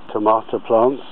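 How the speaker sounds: usual speaking voice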